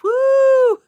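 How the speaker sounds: a quick real cheer of delight; excited whoop. Real, not acted. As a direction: let out a joyful woo. Cheering a viewer's comment.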